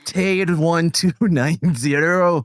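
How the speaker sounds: slow, put-on Scottish accent; badly faked Scottish accent; attempted Scottish accent. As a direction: read names in a laboured fake Scottish. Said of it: "so Scottish accent?".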